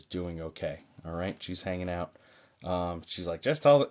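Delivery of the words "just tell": higher, louder cranky quote. Retold or imitated. Medium relaying the older lady's message, mock cranky. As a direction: imitate an impatient, cranky woman. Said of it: "she's very cranky about it".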